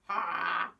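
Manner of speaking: put-on lively sing-song storytelling for kids; reading to children. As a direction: tell it like a picture book to a class. Teacher reads story to children.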